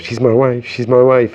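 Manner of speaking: half-acted yell, stressing my; retold shout. Neighbour shouting at the friend in passing, a mild mock shout. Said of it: "he'd just shout".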